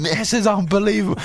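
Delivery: very high-pitched strained shriek without real volume; mock shriek. Fanboy reporter shrieking down the microphone, mock shriek. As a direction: shriek it like an overexcited match reporter. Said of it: "me shrieking down the microphone".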